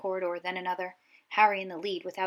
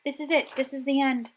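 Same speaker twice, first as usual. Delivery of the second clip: panicked despair; 7 semitones higher, 6 dB louder than usual. A narrator's reading. Ron despairs at locked door.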